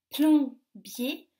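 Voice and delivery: PLOMBIER loud, as plom, then bier; split into syllables. Shown as a demonstration. Teacher helps children listen, PLOMBIER split in two syllables. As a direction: break PLOMBIER into two clear beats. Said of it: "dans la première syllabe".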